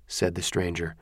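plain reading voice

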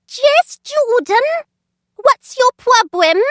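high, weedy teacher trying to sound stern; squeaky schoolmistress scolding, slowly; thin reedy voice. Acted. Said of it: "had a weedy little voice".

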